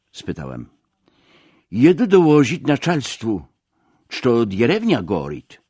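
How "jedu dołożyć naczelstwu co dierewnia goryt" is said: higher, louder official's reply; a touch of a village policeman; lightly voiced Russian policeman. A narrator's reading. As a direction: report the fire, raised and foreign-tongued. Policeman's reply voiced in narrated dialogue.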